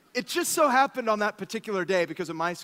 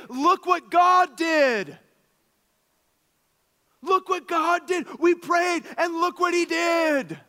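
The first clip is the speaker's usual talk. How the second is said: much higher, louder, slow exultant cry; joyful shout; 12 semitones higher, 7 dB louder, slower than usual. Pretend. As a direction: shout God's praise to the church. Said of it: "that we can all say".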